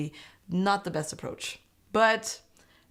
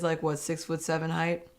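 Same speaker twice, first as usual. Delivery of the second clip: normal talking voice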